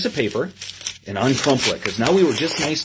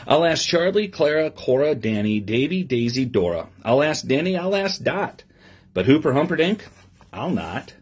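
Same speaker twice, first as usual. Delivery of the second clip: lively storybook reading to a class; reading to children; 2 semitones lower than usual. Acted. Teacher reading a picture book to her class, lively storybook voice. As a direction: read the rhyme aloud with bounce for the kids.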